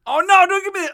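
loud high yelp; startled shout. Real, not acted. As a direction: yelp in sudden alarm. Handed the testicle moon pie.

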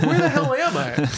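high-pitched, hammed-up lost confusion; mock bewilderment. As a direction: play utterly disoriented for laughs. Retelling friend's feigned bewilderment.

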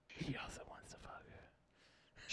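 slow, soft conspiratorial whisper; whispered aside. Real, not acted. Cohost adds a cheeky remark to the pitch in a whisper. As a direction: lean in and whisper it.

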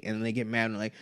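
ordinary talk

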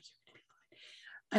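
imitated whisper, almost no sound; hushed reassurance. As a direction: whisper a retold comfort. Retold wife comforting on plane.

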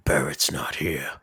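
low, slow stranger voice; put-on voice. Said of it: "are you doing one of your voices".

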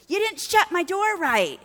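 high-pitched, irritated scolding; mock yelling. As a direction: imitate a young man yelling at an older man. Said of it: "he's yelling at this old guy".

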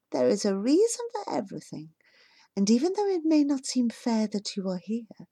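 soft and tender, a hint of a mother speaking from memory; gentle remembered voice. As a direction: speak softly, like a loving voice from the past.

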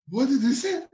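high bewildered question; confused. Retold or imitated. Newcomer asking Johnny for a translation, high and bewildered. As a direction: ask for help, lost.

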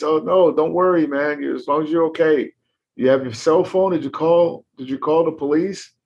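calm him down while panicking yourself; apologetic other driver urging a call; worried reassurance. Acted.

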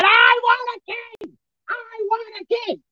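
high-pitched mock scream, drawn out; screaming crowd. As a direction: cry it out like a stubborn mob, stretched and high. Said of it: "they were screaming on".

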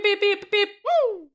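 high beeping sounds; beeping noise. Pretend. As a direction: beep in a high electronic voice. Imitating a beeping device.

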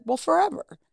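breezy, lilting high pitch; casual. Retold or imitated. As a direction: toss it off airily, pitched high. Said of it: "I said it so casually".

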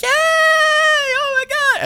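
put-on very high, stretched-out child squeal; squealing delight. Little Charlie squealing with delight at the party, very high and slow. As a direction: squeal like a thrilled little kid.

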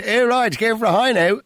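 put-on a quick send-up of a snooty southside Dublin accent. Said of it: "the high nose over on the south side".

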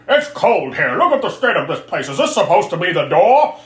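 put-on loud, cranky old-man grumbling; angry old man. Baron complaining about the tower, cranky old-man voice. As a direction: complain like a hammed-up old grouch. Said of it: "the stereotypical angry old man".

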